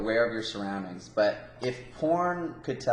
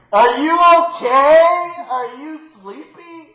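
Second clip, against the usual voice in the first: much higher, slow, sing-song fake concern; mock fake concern; 16 dB louder, slower than usual. Co-host mimicking the scammer distracting the driver, hammed-up high and slow. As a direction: coo it like a too-sweet busybody.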